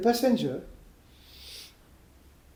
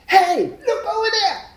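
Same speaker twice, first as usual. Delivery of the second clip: high, loud excited yell; sudden high-pitched shout; distracting passenger; 9 dB louder than usual. Passenger yells to distract the driver.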